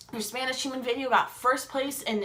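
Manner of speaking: normal talking voice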